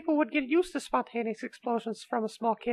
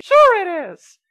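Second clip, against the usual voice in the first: eager insistence; 6 semitones higher, 11 dB louder, slower than usual. Acted. Kitty pitching guns as gifts.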